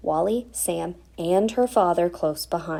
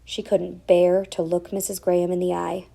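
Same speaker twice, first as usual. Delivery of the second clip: ordinary delivery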